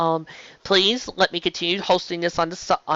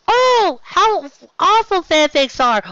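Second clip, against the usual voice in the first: high, whiny mockery of fanfic haters; hammed-up sneer at fanfic, pitched way up; mocking critic; 11 semitones higher, 5 dB louder, slower than usual. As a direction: parrot the snobs in a much higher, sneering voice. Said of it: "everybody who keeps telling me about how".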